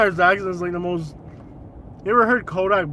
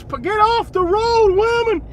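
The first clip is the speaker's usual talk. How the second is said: high, loud road-rage yell; yelling at driver; 11 semitones higher, 7 dB louder than usual. Real, not acted. Driver to a woman in the road, exasperated yell. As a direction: holler it at the car ahead.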